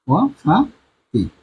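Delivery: ওয়াফাতি drawn out for students; slow sounding out. Shown as a demonstration. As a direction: stretch ওয়াফাতি slowly, each syllable clear. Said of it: "teacher sounding out word".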